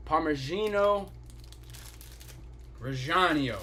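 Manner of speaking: slow, measured Italian pronunciation; Italian accent. Acted. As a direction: lightly put on an Italian accent, taking it slowly. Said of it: "best Italian impression".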